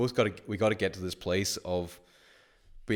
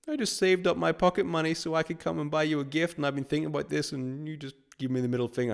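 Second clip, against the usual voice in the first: higher, whiny mock-wounded kid; imitating hurt teen; 7 semitones higher than usual. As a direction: play the betrayed kid, pleading. Hurt teen voiced by teller.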